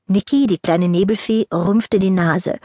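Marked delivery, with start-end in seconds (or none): none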